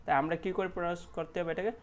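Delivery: usual speaking voice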